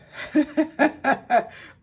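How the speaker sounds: sudden high, loud burst of laughter. Real, not acted. Reader amused by the card, laughing out loud. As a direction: let out a big real laugh.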